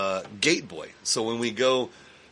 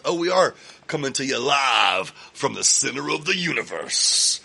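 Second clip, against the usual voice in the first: loud hype announcer, louder; radio announcer; 4 semitones higher, 6 dB louder than usual. Acted. Host hyping the studio live, announcer style. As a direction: boom it out like a live radio intro. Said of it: "announcing the show live".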